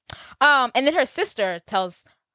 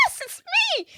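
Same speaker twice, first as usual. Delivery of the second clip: very high-pitched delighted squeal; mock excited squeal; faster than usual. Woman greets her old friend Maddie, mock excited squeal. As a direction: imitate someone overjoyed at being recognized.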